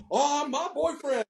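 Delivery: high-pitched mock girlish gush; imitated excited girl. Young girl boasting about her boyfriend, high mock gush. Voicing an excited girl's reply.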